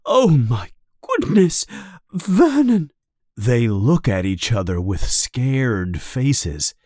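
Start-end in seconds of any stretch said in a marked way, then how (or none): 0.1-2.9 high, gasped-out shock; halting high voice, as if about to faint; faint, struggling to talk. A narrator's reading. Aunt Petunia reacts to the letter, struggling to speak. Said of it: "She has difficulty talking".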